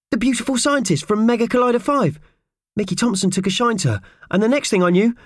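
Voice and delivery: very high character voice, recounting events; eager Purvis. Acted. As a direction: pour out the story in a small high voice. Purvis recounts what happened.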